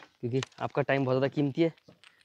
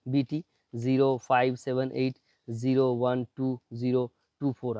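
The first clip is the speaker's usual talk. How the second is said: slow dictation; slower than usual. Shown as a demonstration. Reads serial number to viewers.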